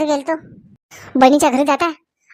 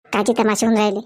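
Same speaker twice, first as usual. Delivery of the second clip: much lower voice repeating it; low second voice; 7 semitones lower than usual. Acted. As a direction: echo it in a lower voice. Different character echoes the question. (खालच्या आवाजात तोच प्रश्न)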